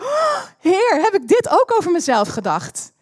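high, loud amazed outburst; astonished exclamation to the Lord; surprised cry. Retold or imitated. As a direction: leap up in pitch with the discovery. Sudden realization, addressing God.

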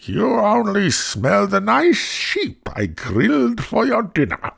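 high, loud, drawn-out scolding wife; high-pitched storybook giantess; giant's wife. A narrator's reading. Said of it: "said his wife".

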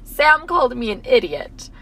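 put-on slow, pouty child voice; sad little boy. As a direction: lightly play the hurt seven-year-old. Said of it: "And he said".

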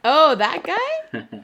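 drawn-out, loud and high "oh, that guy"; slow, loud, theatrical disbelief; mock surprise. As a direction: stretch it out like it's scandalous.

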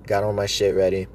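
ordinary talk, nothing marked

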